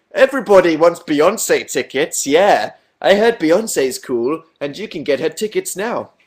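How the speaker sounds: loud, slow hammed-up promo voice; over-the-top ticket plug, drawn out; mock advert. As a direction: sell it like a parody ad. Mock advert after being accused of promoting.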